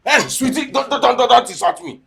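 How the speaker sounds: high, loud stammering indignation; outraged protest. Acted. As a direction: splutter in offence, voice way up. Outraged at insult in quarrel.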